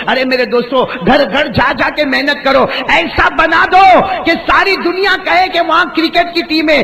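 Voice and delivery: high, loud climactic exhortation; preaching at full force. Real, not acted. Preacher rallying the town's youth, fiery. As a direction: build to a fiery rallying cry.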